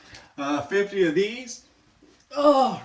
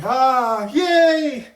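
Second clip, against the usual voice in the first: excited cheer; 6 semitones higher, 10 dB louder than usual. Real, not acted. Cheer after finishing set.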